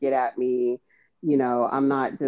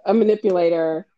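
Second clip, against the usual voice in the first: normal talking voice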